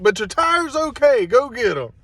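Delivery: telling the driver to go race; upbeat reassurance. Retold or imitated. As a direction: cheer him back into it. Retold cheering driver on.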